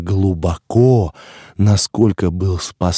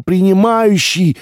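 shouted call; 11 semitones higher than usual. A narrator's reading. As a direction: yell the name with all your strength. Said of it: "закричал я изо всех сил".

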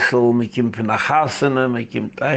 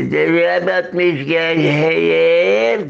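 slow quoted voice; 3 semitones higher, much slower than usual. Retold or imitated. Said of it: "Sagt er".

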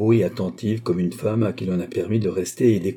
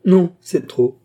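high, loud burst of hilarity; amused outburst; 9 semitones higher, 8 dB louder, slower than usual. A narrator's reading. Queen mocking the secret ambassador story, amused outburst. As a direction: exclaim as if overcome with mirth. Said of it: "fit-elle en riant".

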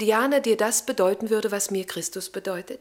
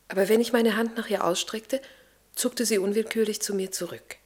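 ordinary delivery

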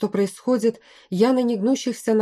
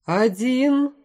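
high, confident call; firm countdown; 5 semitones higher than usual. A narrator's reading. General counting down to the attack, firm and confident. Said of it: "Голос звучал очень твёрдо и уверенно".

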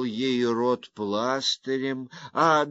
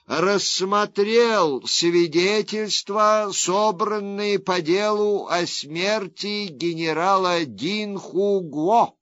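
raised, measured proclamation; formal verdict reading; 6 semitones higher, 4 dB louder than usual. Acted. Judge reads court verdict.

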